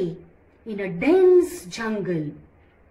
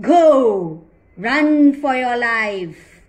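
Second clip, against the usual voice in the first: much louder command to go; booming release; 8 dB louder than usual. Acted. Lion lets the mouse go, loud and commanding. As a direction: send him off with a loud bark.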